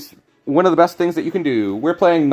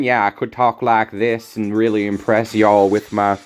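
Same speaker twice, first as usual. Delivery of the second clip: put-on Southern US drawl; playful Southern drawl, words stretched out; Southern accent. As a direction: ham up an American South accent. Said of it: "That was my best Southern accent".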